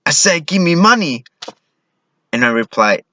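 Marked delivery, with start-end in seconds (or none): 0.1-1.3 high-pitched, exasperated robber demand; insist, fed up at having to repeat it. Retold or imitated. Robber repeating his demand to the clueless clerk, exasperated. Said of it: "he looks exasperated and says".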